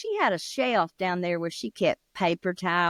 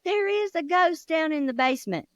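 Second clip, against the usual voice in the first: frightened daddy; 8 semitones higher than usual. Acted. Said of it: "He said".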